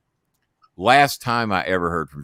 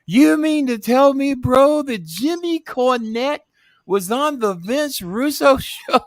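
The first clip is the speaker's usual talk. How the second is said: much higher, slow, amazed put-on voice; voicing Russo; 3 dB louder, slower than usual. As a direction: stretch it out like a thrilled host. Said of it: "I said / Russo asks".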